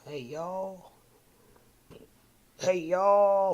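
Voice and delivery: lightly put-on Southern drawl, rising; playful mock-Southern hello; Southern greeting. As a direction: greet folks with a hint of Southern twang. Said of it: "a Southerner said".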